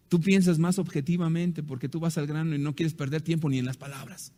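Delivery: ordinary delivery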